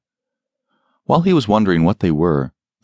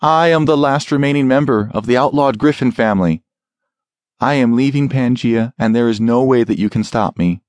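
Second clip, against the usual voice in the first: raised, forced commanding tone; authoritative; 5 semitones higher than usual. Acted. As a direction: put on a brave, firm front. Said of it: "trying to sound authoritative".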